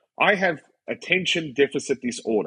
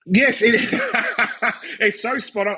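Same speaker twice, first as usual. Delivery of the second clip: high, laughing reply; laughing agreement; 11 semitones higher than usual. Real, not acted. Guest agreeing with the host about a spot-on remark, laughing. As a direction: crack up while agreeing.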